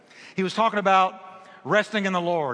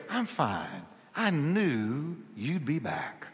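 unbothered calm; 5 dB quieter, slower than usual. Retold or imitated. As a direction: answer the panic with quiet trust. Unbothered Johnny answers his panicked friends.

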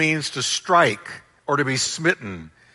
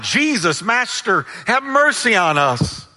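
higher, pleading call for mercy; raised-pitch plea, not a full shout; crying out; 6 semitones higher than usual. Acted. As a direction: cry out to someone at a distance. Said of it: "they were crying out".

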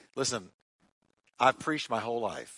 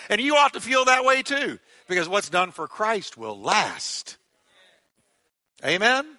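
loud, high call to the congregation; preaching at full force; 9 semitones higher, 6 dB louder than usual. Acted. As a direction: rouse the church to praise. Exhorting the congregation.

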